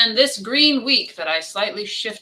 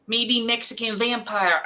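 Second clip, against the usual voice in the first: Bartok bat voice; 4 semitones higher than usual. Pretend. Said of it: "that sounded like something so familiar".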